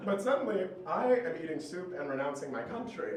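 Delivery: usual speaking voice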